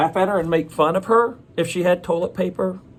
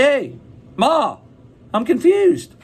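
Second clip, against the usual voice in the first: put-on high, loud kid calling for Ma; confused kid calling out; 10 semitones higher, 4 dB louder, slower than usual. Child tells mom of feeling confused, high mock call.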